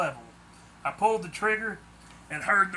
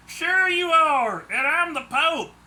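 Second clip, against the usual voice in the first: mocking; 11 semitones higher, 7 dB louder, slower than usual. A narrator's reading. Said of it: "one of them said".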